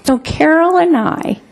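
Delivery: ordinary delivery, nothing marked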